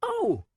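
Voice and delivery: high excited squeal. A narrator's reading. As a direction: squeal with fright and delight. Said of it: "let out a squeal".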